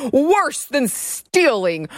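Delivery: very slow, high, incredulous headline read; mock dramatic reading. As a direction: read it like you can barely keep a straight face. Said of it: "I can't even say it with a straight face".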